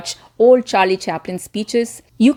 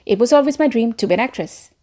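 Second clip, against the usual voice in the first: fast, words fused into one cluster; words clubbed together; 2 semitones higher, faster than usual. Retold or imitated. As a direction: run the words into each other. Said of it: "she would say something like".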